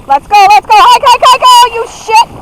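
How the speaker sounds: high, loud hike calls; excited urging. Real, not acted. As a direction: urge the team on. Urging dogs through puddle.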